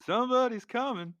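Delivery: film quote impression. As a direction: tease it like a spooky singsong. Quoting film line in voice.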